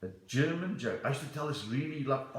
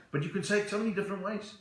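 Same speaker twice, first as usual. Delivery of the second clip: normal talking voice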